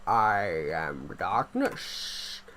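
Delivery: very low, very slow, drawn out; hammed-up deep dark-hero voice; extra-deep voice. Shown as a demonstration. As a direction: drop as deep as it goes and stretch every word. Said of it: "I can go deeper than that".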